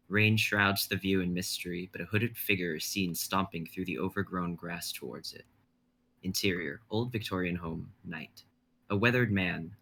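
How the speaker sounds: measured dramatic narration; stage directions read. Acted. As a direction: set the scene like a script reading.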